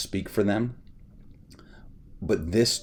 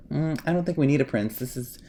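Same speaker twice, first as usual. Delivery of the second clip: higher mocking prissy actress voice; mock impression of the actress, higher; imitating Rachel Zegler; 7 semitones higher than usual. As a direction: mimic her dismissive tone, pitched up. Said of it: "when Rachel Zegler was like".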